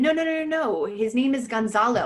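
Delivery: high, loud no-no-no rushed out; panicked retold protest to the rabbi; alarmed denial. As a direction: hurry to set the rabbi straight.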